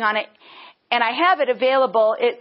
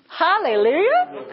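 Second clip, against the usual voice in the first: high, drawn-out hallelujah, rising at the end; exultant praise; 7 semitones higher than usual. Real, not acted. Preacher rejoicing that God bears their name, exultant cry. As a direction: sing out the praise, slow and soaring.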